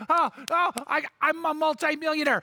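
high, delighted squeal of disbelief; overjoyed and amazed, voice up; excited. Retold or imitated. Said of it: "And she's like".

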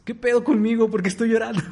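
high, laughing bewilderment; puzzled laughing. Retold or imitated. Dreamer waking in tears, laughing high and puzzled. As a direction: wake up confused and laugh at yourself. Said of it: "Me desperté y dije".

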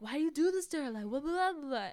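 a hint of an angry brother; brother scolding. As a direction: tell the parents off, lightly. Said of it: "He's like".

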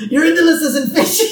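high-pitched boss imitation collapsing into laughter; fail the line through real giggles; cracking up. Real, not acted. Podcaster attempting the boss's insult, really cracking up. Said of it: "I can't do it".

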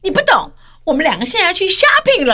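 high-pitched imitation; mock friend's brush-off. Host mimics friend off shopping, high dismissive voice.

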